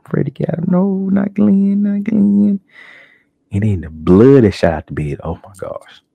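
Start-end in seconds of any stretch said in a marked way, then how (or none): 0.0-2.6 high, slow drawn-out plea; horrified viewer. Retold or imitated. 5.2-6.0 breathy hushed oh my gosh; awed whisper. Real, not acted.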